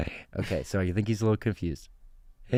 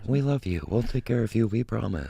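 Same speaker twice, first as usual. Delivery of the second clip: lightly mechanical robot promise, close to his own voice; a hint of a reassuring machine. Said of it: "in the robot voice".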